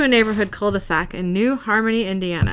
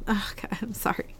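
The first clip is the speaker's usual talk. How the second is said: breathy whispered aside; sorry whispered through giggles; whispered apology; 9 dB quieter than usual. Real, not acted. Apologizing mid-read, breaking up.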